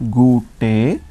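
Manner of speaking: gute slowly as "goo-tay"; slow teacher demo of gute, both vowels full; German pronunciation. Shown as a demonstration. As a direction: sound out gute, final E as "ay". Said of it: "What is sound of E?".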